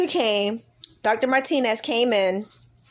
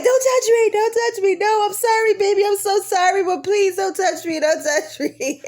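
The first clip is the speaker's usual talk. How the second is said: frantic pleading; 10 semitones higher, 4 dB louder than usual. Retold or imitated. Wife in labor begging husband not to touch her, high loud plea. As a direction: push him away, then soften into apology.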